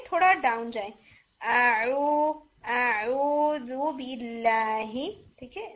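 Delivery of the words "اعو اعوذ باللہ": teacher demonstrating ain; slow ain demo. Shown as a demonstration. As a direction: stretch the ain in اعوذ باللہ. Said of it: "اس طرح سے آپ نے".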